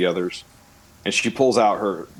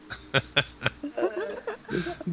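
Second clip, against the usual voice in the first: high manic laugh; 9 dB quieter than usual. Acted. Melting death, high laugh.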